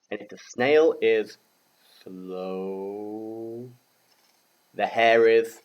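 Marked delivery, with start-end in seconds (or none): 2.0-3.7 "slow" stretched very long and soft; drawn-out slow. Shown as a demonstration. Teacher acts out the snail's speed, drawn-out slow.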